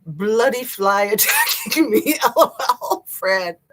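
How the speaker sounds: laughing. Real, not acted. Fly attacking, laughing.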